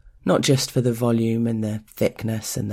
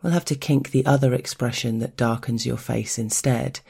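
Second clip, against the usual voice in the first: plain reading voice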